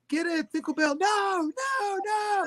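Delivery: root for the horse, then panic; excited race cheering. Retold or imitated. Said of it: "They're like".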